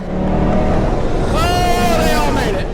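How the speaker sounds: announcer blurting out relief at the near-crash; relieved shout. Real, not acted. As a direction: let it burst out as the wreck is avoided. Cars saved from a wreck.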